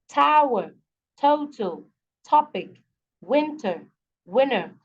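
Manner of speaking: a paced list from Treatment to winner, each first syllable punched, then falling; first-syllable stress. Shown as a demonstration. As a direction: hit TREAT in Treatment, TAR in target, WIN in winner. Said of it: "beginning syllable is where the stress is".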